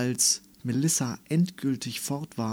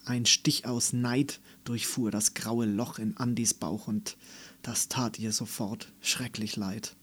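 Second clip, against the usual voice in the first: usual speaking voice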